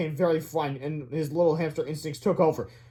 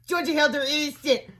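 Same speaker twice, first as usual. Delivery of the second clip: fourth graders' protest; 10 semitones higher than usual. A narrator's reading. Fourth graders defend the two boys.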